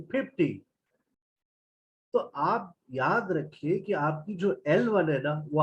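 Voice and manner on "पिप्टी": "फिफ्टी" as "pipti", no F sound; Bengali P for F. Shown as a demonstration. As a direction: swap both Fs in "फिफ्टी" for P, Bengali style. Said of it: "फा sound नहीं है". ("फिफ्टी" को बिना फ़ के, "पिप्टी" बोलें)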